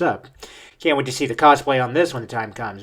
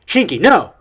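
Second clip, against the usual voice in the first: higher, louder warning shout; urgent cry; 7 semitones higher, 7 dB louder than usual. Acted. Gaara calling to Shinki to stop, urgent shout. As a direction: call out to stop someone.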